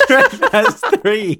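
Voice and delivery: cracking up, high-pitched through the words; helpless laughter. Real, not acted. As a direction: squeeze the words out while laughing. Cracking up at friend's joke.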